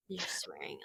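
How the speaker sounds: unvoiced teasing whisper; soft, breathless whisper. Real, not acted. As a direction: hush it like a mock scandal.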